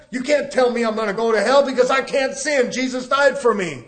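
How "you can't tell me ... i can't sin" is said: ordinary delivery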